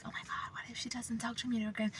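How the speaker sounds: anxious whispered inner thought; hushed fearful what-if; scared whisper. Retold or imitated. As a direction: whisper it like a panicked worry. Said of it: "I was, like, scared".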